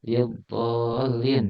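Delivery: lil dhalin read slowly and deliberately; slow example. Shown as a demonstration. Teacher reads the Arabic example slowly to students. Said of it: "Nah, contohnya".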